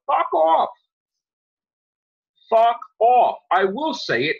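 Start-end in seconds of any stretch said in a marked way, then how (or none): 0.1-0.7 higher, louder furious retort; angry fuck off. Real, not acted. Angry rant at critics. 2.5-3.4 louder, harder second fuck off; louder angry repeat. Real, not acted. Angry rant at critics.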